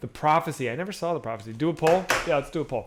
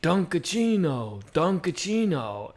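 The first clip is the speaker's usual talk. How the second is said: imitating Al Pacino; 3 semitones higher, slower than usual. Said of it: "The whole Al Pacino, like".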